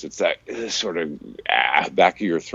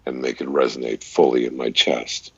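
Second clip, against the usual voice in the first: deep chest voice; 7 semitones lower, 4 dB quieter than usual. Shown as a demonstration. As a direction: lower chest voice, a bit softer. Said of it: "you can tell that I have the ability to even just really".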